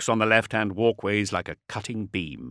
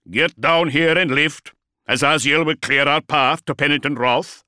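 commanding shout; 4 semitones higher, 5 dB louder than usual. Acted. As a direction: call the order over the din. Commander orders dreadnought.

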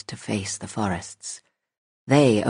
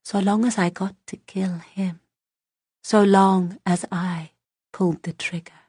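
put-on eerie child whisper; 8 semitones higher than usual. Child whispers intent to kill.

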